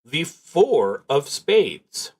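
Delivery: normal talking voice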